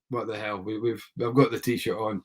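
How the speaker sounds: usual speaking voice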